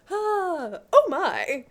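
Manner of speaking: mock surprise on being startled; acted startled gasp. He reacts to finding her there, startled.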